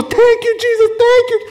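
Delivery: very high, loud cries of gratitude, imitated; ecstatic thanks. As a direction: cry out as the healed woman, overjoyed. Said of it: "she kneels and she goes".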